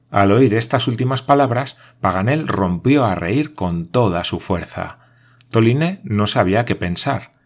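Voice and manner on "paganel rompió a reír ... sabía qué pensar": usual speaking voice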